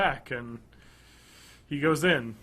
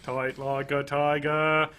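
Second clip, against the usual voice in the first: slow Kennedy-style Boston, toight; hammy JFK impression; JFK Boston accent; slower than usual. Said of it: "by JFK impersonation, I mean doing the JFK".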